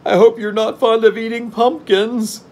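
very high, loud, worried character voice; high-pitched Jack full of foreboding; gloomy. A narrator's reading. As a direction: voice the worry way up high. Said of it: "remarked Jack gloomily".

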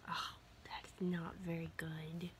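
soft unvoiced whisper. Real, not acted. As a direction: hush it, people are sleeping. People asleep, whispering to dog.

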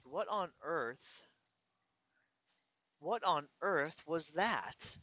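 slow, soft, baffled murmur; quiet and slow, as if the words won't come; stunned disbelief. Retold or imitated. Stunned inner reaction.

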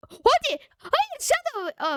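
high, dragged-out wail of dismay; real disappointment, high and slow; dismayed cry. Real, not acted. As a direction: wail as the wrong fruit lands. Bad fruit from spin.

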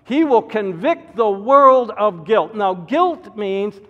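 loud, high, driving pulpit delivery; preaching at full force. Acted. Pastor warning his congregation, preaching at full force. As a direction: hammer the warning home from the pulpit.

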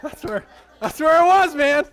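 high, loud outburst through laughter; excited shout. Real, not acted. Speaker shouting over the laughing crowd, excited.

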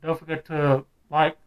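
muffled behind rubber mask; speaking inside full-head mask; through a face mask. Real, not acted. Seen 5: Full rubber mask covers face and mouth throughout; refs unmasked.

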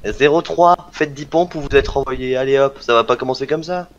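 loud, high barking orders; drill sergeant shout. Acted. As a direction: yell orders at the cadets. Captain barks push-up orders.

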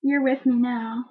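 ordinary talk

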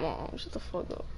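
whispered hushing; whispering. Real, not acted. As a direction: whisper it so mom won't hear. Hushing mom quietly.

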